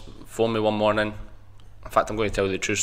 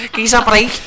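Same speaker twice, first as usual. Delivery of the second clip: loud, high Scouse catchphrase; Scouse accent; 13 semitones higher, 7 dB louder than usual. Shown as a demonstration. Guest repeating his favourite accent for the host, loud Scouse accent. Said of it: "Of course it was Scouse".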